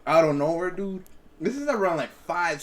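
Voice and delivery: ordinary talk, nothing marked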